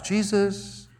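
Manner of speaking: soft, coaxing call of a name; soft gentle wake-up call; gentle nudge. Shown as a demonstration. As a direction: nudge a sleeper awake softly. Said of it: "I doubt that it was a gentle voiced nudge".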